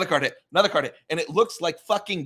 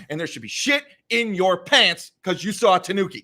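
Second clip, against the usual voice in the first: usual speaking voice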